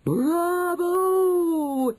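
very high, loud, drawn-out acclaim; shrill-pitched, full-voiced yell; yelled cheer. A narrator's reading. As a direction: cheer like a crowd of ecstatic witches. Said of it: "yelled the audience".

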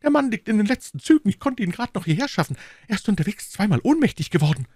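high, fast, panicked report; frantic headmaster gasping out the news; breathless urgency. A narrator's reading. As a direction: rush the words, out of breath. Panting man reports a near-dying friend.

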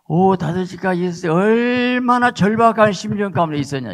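high, loud preaching cry; impassioned preacher; emphatic preaching. Real, not acted. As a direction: lift it up and drive it home to the congregation. Preacher stressing desperation.

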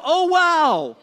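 a hammed-up shocked wow; mock shocked wow. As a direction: play a woman startled by her own outfit. A poorly dressed lady sees the mirror.

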